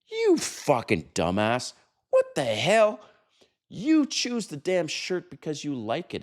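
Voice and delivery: high, incredulous outburst at a fool; exasperated scolding. Acted. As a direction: scold him in exasperation, voice shooting up. Imagined scolding of shirt buyer.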